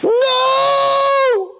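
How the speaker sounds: piercing high anguished cry; anguished soul crying out No; soul's scream. A narrator's reading. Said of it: "voice from within her soul screams".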